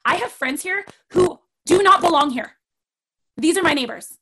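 mild retold indignant protest; firm protest. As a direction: stand your ground firmly. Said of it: "and I was like".

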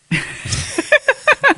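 sudden squeaky laugh; high burst of laughter. Real, not acted. Laughing about his many you-knows. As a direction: laugh at his own habit.

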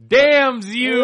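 high, loud movie-hero cry; hammy anguished shout. Retold or imitated. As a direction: bellow it like a tragic hero. Friend echoes the movie line.

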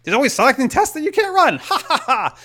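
high nervous joke with a fake ha ha ha; forced laugh. Patient joking to please the nurse, high fake laugh. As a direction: play it up, laughing it off awkwardly.

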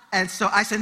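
ordinary talk

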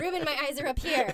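laughing through a mock scolding; giggling while delivering the line; cracking up mid-line. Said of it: "Being like".

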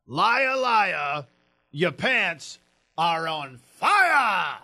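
slow, hammed-up movie-star bellow; Al Pacino. Host imitating a comedian's impression, slow theatrical bellow. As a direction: stretch every word, erupting on the last. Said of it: "his impression of Al Pacino".

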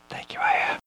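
soft unvoiced whisper. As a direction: breathe the thanks into the wrist. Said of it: "Whisper into my wrist".

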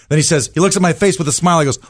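normal talking voice

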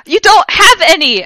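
slow, high, loud mad yell; yelled madly. A narrator's reading. Heroine yelling madly at Snape, loud and slow. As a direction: rage at him. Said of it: "I yelled madly".

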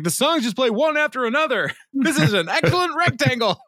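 hammed-up high voice, pleased with a gadget; imitating Ron. Ron admiring the iPod, high-pitched mock awe. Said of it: "he's like".

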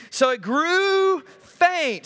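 drawn out, high and slow, hammed-up swoon; milk the fainting for laughs; mock-dramatic swoon. Shown as a demonstration. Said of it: "Sorry, I added that".

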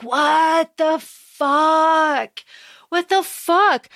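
high, drawn-out "what the fuck"; mock stunned disbelief. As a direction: stretch the words out in acted disbelief. Ali reacting to crocodile man.

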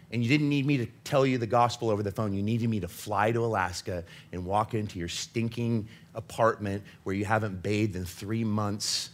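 normal talking voice

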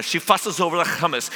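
ordinary talk

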